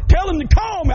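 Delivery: loud, high, thrilled call-out; eager preacher's shout; excited shout. Retold or imitated. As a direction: shout it with delight, laughing it off. Preacher retelling his excited reply.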